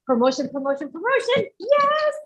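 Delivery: high, climbing chant ending in a jubilant yes; excited anticipation. Retold or imitated. Saleswoman retelling her hopes of promotion to the host, excited.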